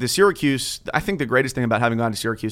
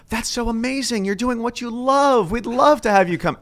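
much higher, gushing recruiter voice; 12 semitones higher than usual. Retold or imitated. Recruiter gushes at honest applicant, much higher delighted. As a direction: gush like a thrilled recruiter. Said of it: "And she goes".

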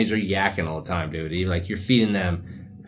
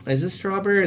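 squeaky disbelieving question; puzzled high question; 11 semitones higher than usual. Retold or imitated. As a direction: ask it in high confusion. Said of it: "you're like".

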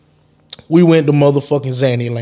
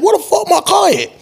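even higher, strained panic; frantic question. Retold or imitated. Frantic owner stands up over his missing car, very high. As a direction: jump up and ask it at the top of the voice. Stood up, going crazy.

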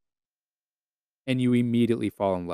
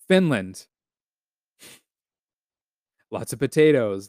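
ordinary delivery

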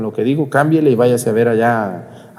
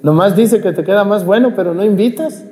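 teasing challenge; 7 semitones higher than usual. Retold or imitated. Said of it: "a algunas sí le he dicho".

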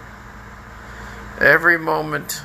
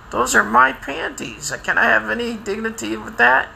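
mock indignation; 9 semitones higher than usual. Acted retort to church lady.

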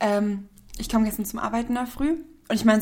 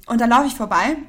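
ordinary delivery

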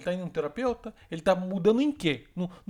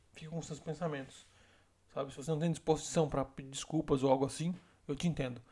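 soft, steady and disappointed, growing much quieter; calm, hurt, quiet; 4 semitones lower, 6 dB quieter than usual. Shown as a demonstration. Woman quietly telling her boyfriend she won't argue, hurt and soft. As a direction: speak softly, refuse to fight. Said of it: "quando a mulher fala assim, ó".

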